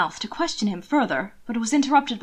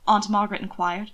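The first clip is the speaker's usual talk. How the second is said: plain reading voice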